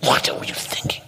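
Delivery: whispered inner reproach; scolding whisper. Retold or imitated. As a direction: hiss it as your inner critic.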